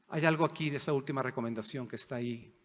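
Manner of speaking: ordinary delivery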